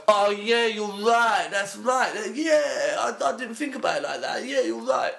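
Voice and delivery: mock partygoer falling for the theory, high and eager; gullible convert. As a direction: squeak out eager agreement like a fool won over. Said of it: "they'll go".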